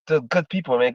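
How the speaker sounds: ordinary talk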